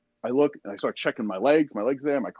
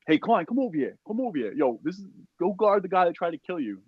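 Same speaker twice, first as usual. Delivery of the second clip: high-pitched imitated summons; mock a squadmate calling him across, pitched up; calling over; 8 semitones higher than usual. Squad calls Klein over.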